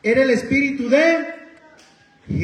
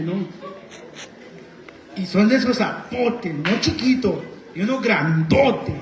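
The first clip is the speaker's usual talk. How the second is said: ordinary talk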